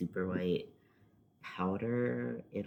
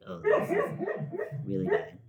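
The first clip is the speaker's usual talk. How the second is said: much higher, slow mock-horrified voice; mock dismay; 6 dB louder, slower than usual. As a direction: squeak it out in dismay at herself. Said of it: "And then I was like".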